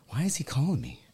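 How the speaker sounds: worried whisper to himself. Retold or imitated. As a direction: whisper it anxiously under breath. Anxious thought during a game.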